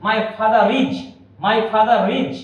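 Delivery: father is linked as fatharis with R sounded; connected R. Shown as a demonstration. Teacher to students, demoing the connected R. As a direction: run father into is through the R. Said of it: "connect করে বললে এটা হয়ে যাবে".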